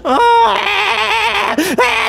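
high scream. Real, not acted. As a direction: scream in real frustration. Screaming when caught. (ऊँची चीख)

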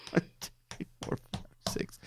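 whispered, breathless counting through laughter; laughing count. Real, not acted. Counting moves on the board, breathless with laughter. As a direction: count while giggling.

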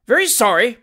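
high, loud furious echo. Acted. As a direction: throw his words back in fury. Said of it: "said the furious magistrate".

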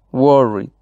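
"worried" with force on WOR; "worried" stressed at the start, then falling; stress on first syllable. Shown as a demonstration. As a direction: lean on the W syllable that opens "worried". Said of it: "fuerza o acentuación en la primera sílaba".

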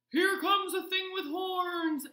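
high, loud rabbit alarm; shouting rabbit. A narrator's reading. Rabbit warns of a horned thing, high shout. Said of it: "shouted a rabbit".

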